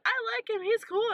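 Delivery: high-pitched quoted mom; mom imitation. Mom's approval acted in retold quote.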